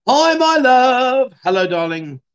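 high, loud and drawn out, full of love; calling sweetly to someone dear; affectionate greeting. Real, not acted. Greeting Mo as she comes into view, loving and drawn out.

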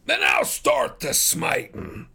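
very high, loud, slow roar of defiance; furious exclamation. A narrator's reading. Farmer about to rush for his gun, furious. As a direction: explode back at them. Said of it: "exclaimed Ferrier furiously".

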